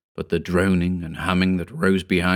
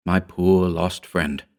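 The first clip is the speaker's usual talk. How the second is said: plain reading voice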